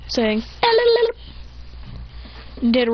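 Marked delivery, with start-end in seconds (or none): 0.6-1.1 very high tongue-wagging babble; silly taunting noise. Acted. Mocking noise after a knockout.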